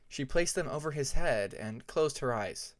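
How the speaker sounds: plain reading voice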